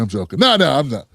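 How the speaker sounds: ordinary talk, nothing marked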